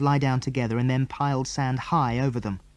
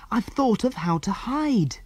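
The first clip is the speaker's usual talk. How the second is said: breathless; 10 semitones higher than usual. A narrator's reading. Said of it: "said Jill breathlessly".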